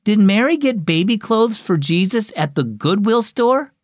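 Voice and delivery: put-on high, curious small child; little girl voice. A narrator's reading. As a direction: ask it like a wide-eyed four-year-old. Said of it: "Then she asked".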